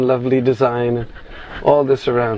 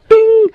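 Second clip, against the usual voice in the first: jingle chime; 8 dB louder than usual. Retold or imitated. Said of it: "then all of a sudden".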